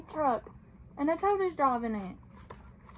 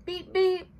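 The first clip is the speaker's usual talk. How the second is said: higher, louder beep beep; truck horn beep; 6 semitones higher, 6 dB louder than usual. Acted. Little Blue welcomes friends, horn beep. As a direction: toot it like a cheerful horn. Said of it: "said Little Blue".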